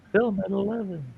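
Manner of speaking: high-pitched playful news promo; mock newscaster. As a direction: sing it up like a cartoon anchor.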